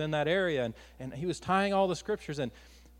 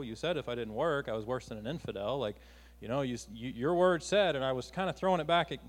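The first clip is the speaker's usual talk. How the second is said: normal talking voice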